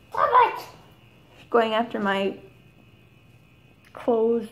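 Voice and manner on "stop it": startled yelp. Real, not acted. Cat attacking her clothes.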